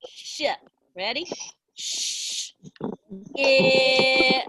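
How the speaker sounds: "Sh" hissed without voice, "It" punched out; segmented sounds. Shown as a demonstration. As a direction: break ship into its sounds. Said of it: "do the word ship with me".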